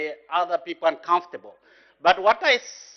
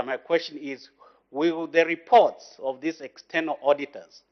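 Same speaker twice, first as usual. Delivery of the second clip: ordinary talk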